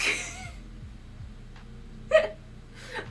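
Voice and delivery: squealing high laugh; high laughter. Real, not acted. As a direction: burst out laughing. Laughing at the bit.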